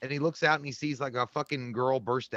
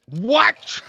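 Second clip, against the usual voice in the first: loud baffled what; 7 semitones higher, 9 dB louder than usual. Retold or imitated. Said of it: "He was like".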